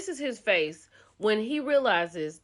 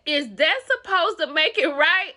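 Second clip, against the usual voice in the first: higher and louder, outraged; a mild mock of the boyfriend's outrage; indignant retort; 7 semitones higher, 8 dB louder than usual. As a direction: fire it back in disbelief. Angry boyfriend confronts host.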